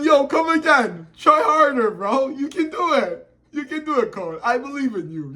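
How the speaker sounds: high-pitched mock encouragement; toying taunt. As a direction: cheer on a rival to needle him. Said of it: "He's just toying with his food".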